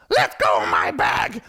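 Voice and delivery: low, loud, slow snap at the driver; mock angry order; clutching her bag, loud and low; angry demand. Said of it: "one lady said".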